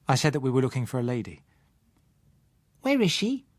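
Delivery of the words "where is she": put-on high child voice, curious; a child asking on the road; curious little girl. Child Susan voiced in story.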